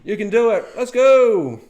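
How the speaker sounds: high, loud little cheerleader; pep up the big ships like an eager little tugboat; cheering tugboat. Pretend. Tiny tugboat cheering on the warships, high and loud. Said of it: "It's like their little".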